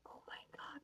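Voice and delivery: whispered aside. Real, not acted. Aside about the zealous woman.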